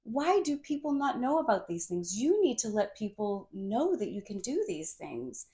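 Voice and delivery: indignant wonder at a friend hiding skills; mock disbelief. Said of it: "and she's like".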